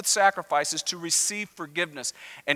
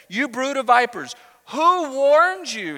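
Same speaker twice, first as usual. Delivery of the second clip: raised, high-pitched declamation, slowing down; prophetic rebuke; 7 semitones higher, 5 dB louder, slower than usual. Acted. John the Baptist challenging the crowds, raised prophetic rebuke. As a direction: hurl it out like a preaching prophet.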